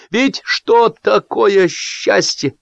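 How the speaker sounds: put-on high, slow philosophizing drunk; breaking through cheers. A narrator's reading. Drunk Zolotar cutting through the cheering, musing. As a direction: muse loftily over the noise. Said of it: "пробил сквозь «Ура»".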